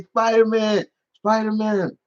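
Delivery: put-on high, eager little kid voice; a hint of a thrilled toddler calling the hero; excited little boy. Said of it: "he's looking at me".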